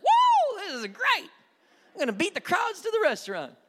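high, loud whooping glee; mock gleeful churchgoer. Preacher voicing congregants happy about a short sermon, high whooping glee. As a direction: cheer like you got out early. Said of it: "others of you be like".

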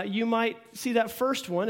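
normal talking voice

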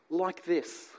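usual speaking voice

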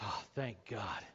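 low, slow, soft exhale; relieved sigh. Acted. Man sitting back in saddle after near fall, relieved. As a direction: let the relief sink out slowly.

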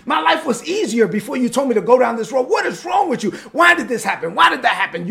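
acted upset with God. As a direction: yell your complaint up at God in frustration. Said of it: "You're upset with God".

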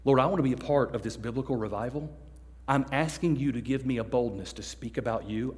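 quiet earnest prayer; sincere prayer. Shown as a demonstration. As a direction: pray it like you truly mean it. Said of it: "pray a prayer to God with a full heart".

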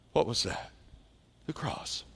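whispered, hardly any voice; hushed whisper. Real, not acted. Preacher whispering the answer to his congregation. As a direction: breathe it out as a secret. Sermon climax, answering own question.

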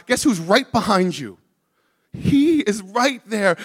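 urgent sermon building in volume; fervent, emphatic preacher's delivery; impassioned preaching. Real, not acted.

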